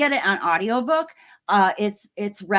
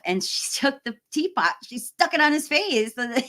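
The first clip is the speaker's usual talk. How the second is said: ordinary delivery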